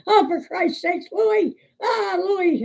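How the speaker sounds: high-pitched mock exasperation; imitated uproar. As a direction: cry out like a crowd that's been had again. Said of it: "everybody goes nuts again".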